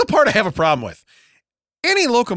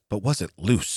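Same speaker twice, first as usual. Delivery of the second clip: lower, gruff question; low gruff boss voice; 8 semitones lower, 5 dB quieter than usual. Retold or imitated. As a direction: growl it like a skeptical boss. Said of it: "He goes".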